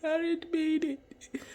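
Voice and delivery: high, pleading cry acted out; imitating his younger self crying for his mom; retold crying plea. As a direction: beg for mama through tears. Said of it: "Just fucking crying and shit".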